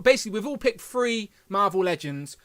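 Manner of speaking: ordinary talk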